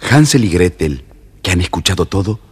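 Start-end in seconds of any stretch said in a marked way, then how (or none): none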